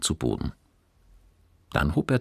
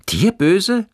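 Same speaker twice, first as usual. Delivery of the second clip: very high, loud startled question; calling out; 9 dB louder than usual. A narrator's reading. Boy reacts to his friend's warning, calling out. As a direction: cry it out in surprise. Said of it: "rief Johannes".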